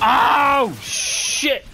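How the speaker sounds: sudden high startled cry, breath breaking on shit; jolted by a surprise enemy; startled yelp. Real, not acted. Gamer caught off guard, a high startled yelp.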